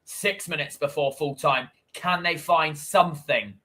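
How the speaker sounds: lively football commentary, pushing on the chances; commentating the final minutes for the viewers, eager play-by-play. Acted. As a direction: call the match like a TV commentator. Does own live match commentary.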